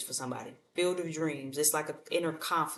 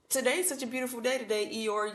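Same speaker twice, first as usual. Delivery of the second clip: higher, chirpy mock cheer; cheerful friend; 7 semitones higher than usual. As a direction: brighten up for a sulky pal.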